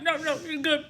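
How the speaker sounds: laughing mock reassurance. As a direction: imitate mom while laughing. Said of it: "You're like".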